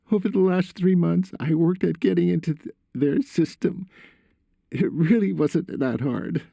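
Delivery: high-pitched son explaining himself; confessing son. A narrator's reading.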